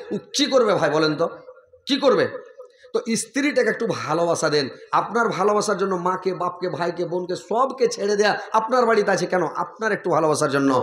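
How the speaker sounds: usual speaking voice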